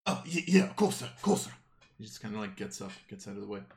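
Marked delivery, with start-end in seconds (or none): 0.1-1.5 higher, louder, stammering goblin underling; eager, nervous yes-man; flustered henchman. Acted. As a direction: hurry to obey the boss, tripping over it. Flustered goblin obeys his boss.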